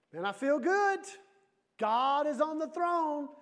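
high, loud, jubilant; hearty confidence. Acted. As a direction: declare it loud and high, stretching the words. Troubled person answers with bold cheer.

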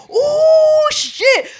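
very high, loud ooh shit; thrilled shriek. Retold or imitated. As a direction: squeal watching the drama. Thrilled watching fight.

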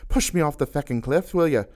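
high, angry scream at him. A narrator's reading. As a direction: yell it, furious and betrayed. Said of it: "as she screamed at him".

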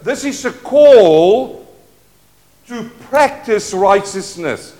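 normal talking voice

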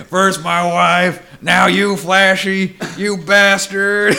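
high, loud, drawn-out mock cry to the heavens; hammed-up vow of vengeance; melodramatic vengeful scream. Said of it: "He screams so loud".

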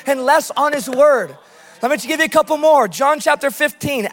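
ordinary delivery

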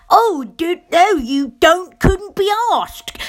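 high, exaggerated mock British accent, slowing on the last words; bad British accent. Americans mocking her accent, a bad fake British accent. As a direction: ham up a clumsy American take on a British accent. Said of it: "an absolutely abysmal impression of my accent".

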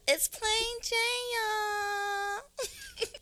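high, stretched-out show intro; long, high-pitched signature intro; drawn-out name announcement. Acted. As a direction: sing out your name high, holding the last word. Host announcing her own name in intro.

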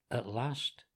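ordinary delivery, nothing marked

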